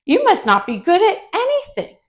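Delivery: high, loud taunting character voice; mocking bully. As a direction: tease the little bee in a high, sing-song voice. Bully character taunts Buzzy Bee.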